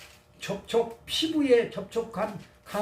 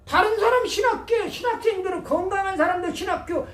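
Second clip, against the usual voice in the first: raised, pressing sermon storytelling; impassioned preaching; 6 semitones higher, 4 dB louder than usual. Real, not acted. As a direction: build it up with emphatic high-pitched bursts.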